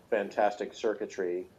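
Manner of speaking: usual speaking voice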